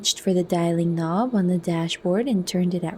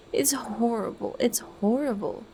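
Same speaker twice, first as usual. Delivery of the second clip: crying; 5 semitones higher, 4 dB quieter than usual. A narrator's reading. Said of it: "But Lenina was crying".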